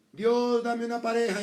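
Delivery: high, loud begging to God; mock pleading prayer. Preacher mimicking a lazy believer's prayer, high and loud. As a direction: beg heaven for a partner, hammed-up.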